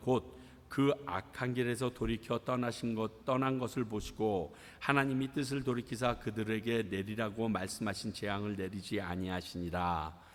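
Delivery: low, even recitation; scripture reading. Real, not acted. Pastor reading Jonah to the congregation, measured scripture reading. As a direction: read the verse aloud in a measured pulpit tone. Reads the scripture passage aloud.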